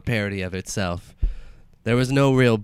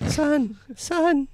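high-pitched dead mother voice. Acted. Dead mother calls her son.

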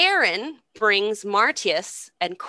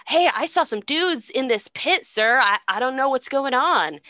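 higher, put-on innocent informer; feigned innocence; 6 semitones higher, 3 dB louder than usual. Schemer reporting to the emperor, hammed-up innocence. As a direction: report it as if utterly baffled.

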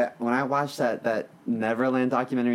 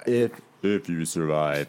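lowered, slow mock-sultry voice; sexy voice; 8 semitones lower, slower than usual. Shown as a demonstration. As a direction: say it low and slow, like a hammed-up seduction. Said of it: "oh, I have a sexy voice".

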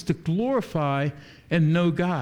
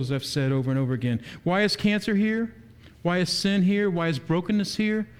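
usual speaking voice

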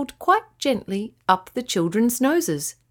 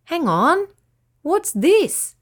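put-on higher, startled child's exclamation; surprised cry; 7 semitones higher, 4 dB louder, slower than usual. A narrator's reading. Fred spots something in his hand, surprised cry. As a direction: gasp it out in sudden surprise. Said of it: "he cried".

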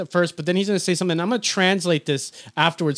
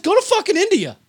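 high-pitched exasperated imitation; mock presidential rant; 13 semitones higher, 3 dB louder than usual. Host acting out the president's dismissal, high mock rant. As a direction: send them packing in a high, ranting mock voice. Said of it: "'cause he says".

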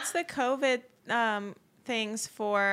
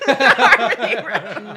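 excited; 13 dB louder, slower than usual. Real, not acted. Echoes the joke, loud.

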